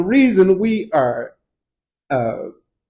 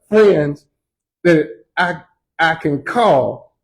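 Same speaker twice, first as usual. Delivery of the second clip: usual speaking voice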